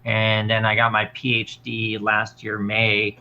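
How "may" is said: usual speaking voice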